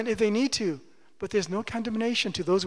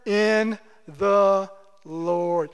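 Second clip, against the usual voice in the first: very slow answer; much slower than usual. Shown as a demonstration. Pastor answering his own question, very slowly. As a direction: stretch every word out. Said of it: "Stand fast where?".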